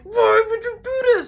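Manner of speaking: outraged shriek. Retold or imitated. Brother after headphones popped.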